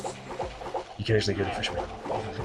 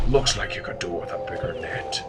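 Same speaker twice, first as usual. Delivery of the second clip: put-on grumpy old fisherman; 3 semitones lower, 3 dB quieter than usual. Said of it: "One of the things he says".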